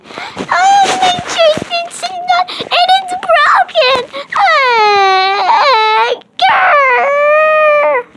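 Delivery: very high, loud wailing cub; baby bear bawls over the broken chair; crying baby bear. Acted. Child voicing baby bear, high and loud, fake-crying over broken chair. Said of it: "the Baby Bear said".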